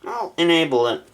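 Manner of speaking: normal talking voice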